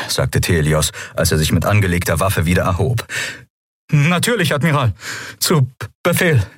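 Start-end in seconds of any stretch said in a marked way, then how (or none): 3.9-6.5 high-pitched stammering reply; flustered obedience. A narrator's reading. Captured pilot to admiral at gunpoint, high flustered stammer. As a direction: stumble over the salute, pitched high.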